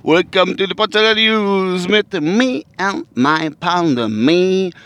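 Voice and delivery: slow, high announcer opening; news anchor intro. Acted. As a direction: announce it like a newsreader.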